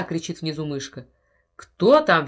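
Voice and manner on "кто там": loud angry bellow; furious roar. Acted. Henry roars at strangers in his house, loud furious bellow. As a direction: thunder at the intruders. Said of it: "в ярости гремит Генри".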